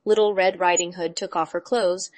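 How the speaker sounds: plain reading voice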